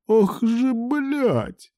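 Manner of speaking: high, drawn-out wailed curse; howling. A narrator's reading. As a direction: let it rise into a long howl. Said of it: "провыл Илья". (протяжный высокий вой)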